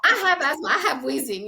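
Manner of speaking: high, loud defensive retort; hammed-up kid's complaint; mock indignant protest. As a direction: insist on it, exasperated and comic. Retold protest to uncle.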